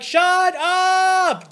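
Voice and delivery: yell. Retold or imitated. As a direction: bark it out to shut her up at once. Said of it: "And I'm like".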